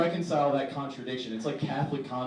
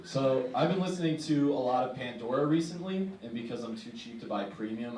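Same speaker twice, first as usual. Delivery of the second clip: usual speaking voice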